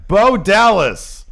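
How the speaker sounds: slow, loud, stretched-out name call; announcer call. Acted. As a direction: draw the name out like a ring announcer. Announcing the next countdown entry.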